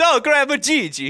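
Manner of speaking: high, loud mock grandkid call; high loud playful grandkid voice; mock greeting. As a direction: ham up the kid's greeting. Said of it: "it's funnier if it's".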